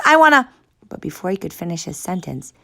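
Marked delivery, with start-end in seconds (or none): 0.0-0.5 cut-off loud high yell; yell, then get cut off. Acted. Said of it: "Noah yelled".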